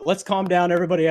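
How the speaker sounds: retold friend calling for calm. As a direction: raise your voice to calm the room. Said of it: "he goes".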